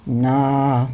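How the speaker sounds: low, drawn-out bear grumble; a hint of a gruff bear; grumbling bear. A narrator's reading. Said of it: "brummte der Bär".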